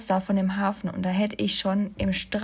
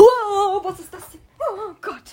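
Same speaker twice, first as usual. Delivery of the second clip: hoher, panischer Aufschrei; erschrocken; 10 semitones higher, 7 dB louder, slower than usual. Real, not acted. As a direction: vor Ekel und Schreck quieken. Schreck über reingeflogenes Tier. (erschrockener Ausruf)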